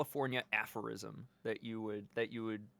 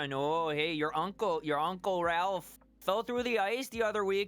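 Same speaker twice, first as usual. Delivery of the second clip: put-on lightly raised Minnesota accent, folksy uncle; 4 semitones higher than usual. Friend lecturing kids about ice in a light Minnesota accent. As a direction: warn the kiddos in a Minnesota accent. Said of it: "It's very Minnesota".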